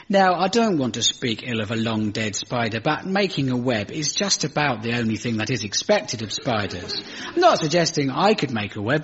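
normal talking voice

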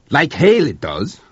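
loud, slow retort; sharp retort. A narrator's reading. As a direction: fire it back hard. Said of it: "Blanchard retorted".

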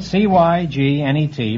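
C Y G N E T slowly, letter by letter; spelled out. Shown as a demonstration. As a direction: spell C Y G N E T with each letter separate. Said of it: "It's spelled".

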